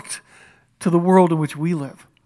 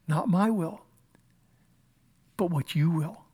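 soft surrender; 8 dB quieter than usual. Acted. Jesus' prayer quoted softly.

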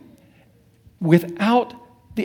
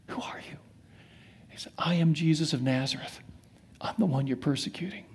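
whisper; 10 dB quieter, faster than usual. Acted. Voice from heaven to Saul.

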